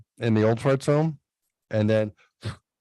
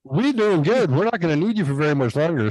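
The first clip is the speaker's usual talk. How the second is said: cocky; 6 semitones higher than usual. Retold or imitated. Voicing himself mockingly to principal.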